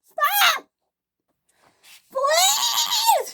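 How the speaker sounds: very high shrieked begging; screamed plea. A narrator's reading. Millie being pushed off the rails, screamed plea. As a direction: shriek each word separately. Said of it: "she screamed".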